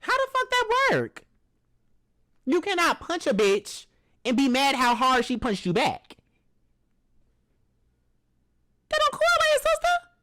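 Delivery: high-pitched outraged scream; furious shout. Real, not acted. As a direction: yell it like you can't believe the nerve. Furious rant at the woman.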